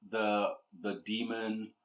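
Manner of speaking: ordinary talk, nothing marked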